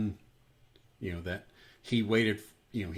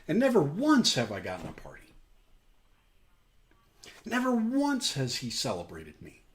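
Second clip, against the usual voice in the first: resentful complaint; 6 semitones higher than usual. Acted. Older brother complains of unfairness.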